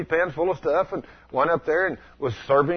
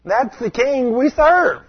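joyful shout; 10 semitones higher, 4 dB louder, much slower than usual. Real, not acted. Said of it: "preacher exclaims".

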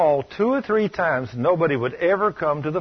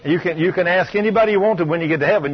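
ordinary talk, nothing marked

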